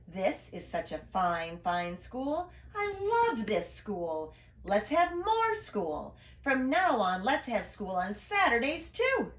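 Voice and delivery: slow, grand announcement; proud principal. Acted. Principal announcing Saturday school to everyone, slow and proud. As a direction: savour each word of the big announcement.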